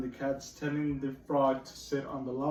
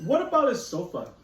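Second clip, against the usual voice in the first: higher, louder hopeful frog; eager frog; 8 semitones higher, 7 dB louder than usual. Acted. Teacher voicing the frog, eager higher question. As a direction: ask brightly as the frog. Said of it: "said the frog".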